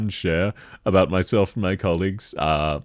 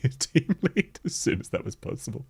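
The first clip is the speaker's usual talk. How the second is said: ordinary talk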